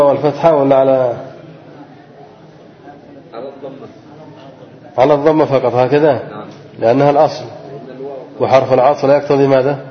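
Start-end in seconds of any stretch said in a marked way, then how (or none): none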